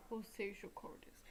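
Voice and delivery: soft, half-whispered game title; hushed and understated; quiet title repeat. Real, not acted. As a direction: say it under your breath, unstretched. Same title muttered back quietly.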